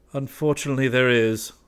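a hint of a grim growl. West grimly growls to Braith, mildly. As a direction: grumble it darkly. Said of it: "growled West".

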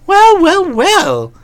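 high rising "well"; amused surprise. Acted. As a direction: savour the gossip. Bertie amused.

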